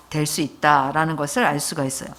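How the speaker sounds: ordinary delivery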